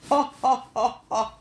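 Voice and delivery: hammed-up evil villain laugh; a mock Machiavellian cackle; demonic laugh. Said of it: "je dois faire un rire démoniaque, un rire machiavélique".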